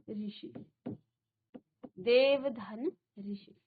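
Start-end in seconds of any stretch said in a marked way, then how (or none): 2.0-3.5 देव धन ऋषि drawn out word by word; slow dictation. Shown as a demonstration. Teacher setting up the sandhi, slow dictation. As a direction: lay out देव धन ऋषि slowly. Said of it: "इन दोनों की संधि करनी है".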